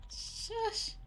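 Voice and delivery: normal talking voice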